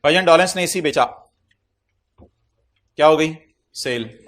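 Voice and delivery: loud teacher's demand to the class; calling out to class. Real, not acted. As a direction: call the question out loud to a room.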